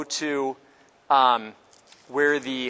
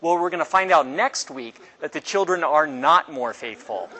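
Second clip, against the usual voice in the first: ordinary delivery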